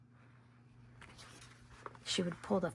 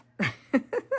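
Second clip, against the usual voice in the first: high laugh; 7 semitones higher, 11 dB louder than usual. Real, not acted. Laughs at herself.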